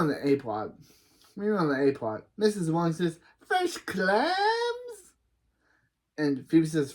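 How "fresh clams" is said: high, rising sing-out; high vendor call. Acted. Missus Wong offering fresh clams, high call. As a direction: call it out high, stretching the last word upward.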